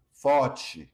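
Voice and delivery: Forte with the T softened to a ch sound; Brazilian softened T. Shown as a demonstration. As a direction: say Forte as fortchi. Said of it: "Notice the softening of the T".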